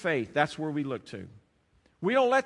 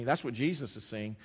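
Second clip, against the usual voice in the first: usual speaking voice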